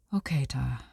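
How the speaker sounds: soft whispered reply; wife whispers to her husband, sad and patient; whispered okay. Acted. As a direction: let it out on a breath, resigned. Wife answers softly, sad smile.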